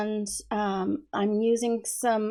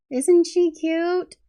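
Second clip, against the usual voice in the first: cutesy gush; 8 semitones higher, 7 dB louder, much slower than usual. Real, not acted. Gushing over her finished page.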